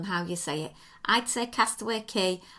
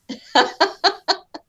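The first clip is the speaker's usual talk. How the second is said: loud laugh; 10 semitones higher, 11 dB louder than usual. Real, not acted. Shared joke about accents.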